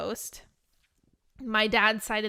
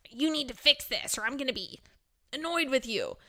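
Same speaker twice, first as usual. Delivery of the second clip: bossy manager; 3 semitones higher, 3 dB quieter, faster than usual. Retold or imitated. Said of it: "Managers are, like, very dominant, aggressive".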